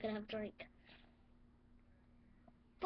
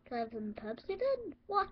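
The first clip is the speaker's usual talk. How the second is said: usual speaking voice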